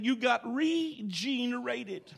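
regenerated drawn out very slowly, leaning on gene; slow right down and land hard on the gene of regenerated; preacher stressing gene in regenerated, very slow. Shown as a demonstration. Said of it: "I stress the word gene".

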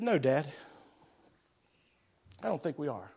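a boy's small, low-voiced confession; sheepish admission. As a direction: confess it quietly to your father. Boy admits truth before church.

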